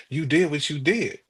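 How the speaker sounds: usual speaking voice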